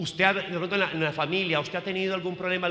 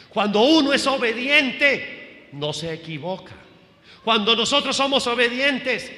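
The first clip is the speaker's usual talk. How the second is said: raised, high pulpit voice; high-pitched, driving sermon delivery; emphatic preaching; 6 semitones higher, 4 dB louder than usual. Real, not acted. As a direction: proclaim it to the congregation in punched phrases.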